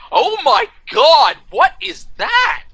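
high, slow, drawn-out horror; hammed-up gasping disbelief, starting loud and stretched; mock shock. Co-star seeing the picture at a glance, hammed-up shock.

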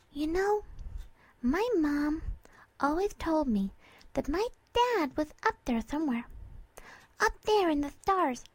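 put-on high, girlish character voice; Dinky, wistful young mare. Mare telling her friend about her dad, wistful. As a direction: share a childhood belief in a high, tender voice.

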